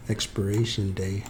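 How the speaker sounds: usual speaking voice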